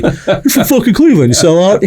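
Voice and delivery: high-pitched, laughing mock accusation; mock jeering. Hometown friends ribbing him for saying soda, high mock jeering.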